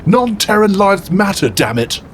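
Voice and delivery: Horace building to a high, heated outburst; impassioned rant. Acted.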